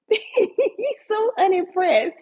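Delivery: high, slow laughing words; real amused laugh. Real, not acted. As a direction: laugh it out slowly. Laughing at dog's reaction.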